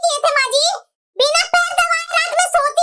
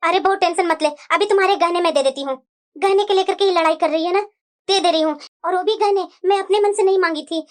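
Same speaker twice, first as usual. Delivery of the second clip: much lower mother-in-law voice, soothing; a lower, older voice calming the bride; 10 semitones lower than usual. Acted. The mother-in-law reassures her daughter-in-law in a much lower voice.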